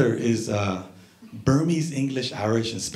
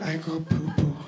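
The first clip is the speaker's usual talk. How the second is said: put-on soft, small old man's voice; old man's timid bathroom request; feeble grandpa plea; 6 dB quieter than usual. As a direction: plead quietly like a helpless grandpa. Grandpa pleads in retold scene.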